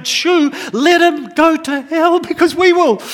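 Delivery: loud, high, slow exasperated cry; mock-despairing loud protest; exasperated shout. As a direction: throw up your hands and yell it. Exasperated joke at evangelist friend.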